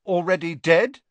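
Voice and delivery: eagerly supplying the missing words; helpful. A narrator's reading. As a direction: chip in to finish the sentence. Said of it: "said Ron helpfully".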